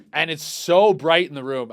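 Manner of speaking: ordinary talk, nothing marked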